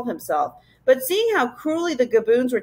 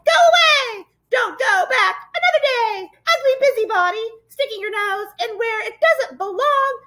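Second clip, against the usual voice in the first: high-pitched jeering monster shriek; shrieking creature taunts. A narrator's reading. Furry monsters driving intruders away, shrieking. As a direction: screech insults like a pack of nasty little beasts. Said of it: "They were shrieking and skulking".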